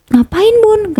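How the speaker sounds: high-pitched imitation of her child; child questioning mother. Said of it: "aku ngomong lah sama anakku yang pertama".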